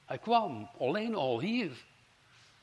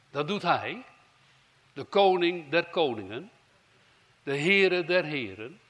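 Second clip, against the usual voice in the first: loud proclamation; 7 dB louder than usual. Real, not acted. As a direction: proclaim his titles with weight. Proclaiming the king of kings. (luid en plechtig verkondigd)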